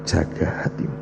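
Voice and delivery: whispered, soft and low; hushed. Retold or imitated. Preacher whispers God's word to him. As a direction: breathe it like a holy secret.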